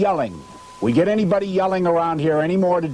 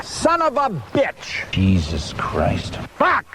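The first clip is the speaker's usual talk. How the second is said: real frustrated cursing; much slower than usual. Real, not acted. Actor blowing his line again, furious cursing. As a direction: vent the rage at the lost line. Forgets line, curses repeatedly.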